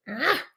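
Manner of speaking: sharp high indignant "ah"; a yelp of offended surprise; mock affronted gasp. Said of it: "And I was like".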